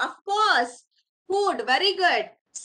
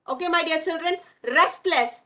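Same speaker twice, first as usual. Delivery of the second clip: ordinary delivery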